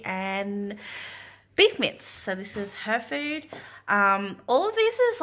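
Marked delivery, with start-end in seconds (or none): 1.5-2.0 excited. Real, not acted. Cheerful listing to baby. 4.5-5.2 excited. Real, not acted. Enthusing about food.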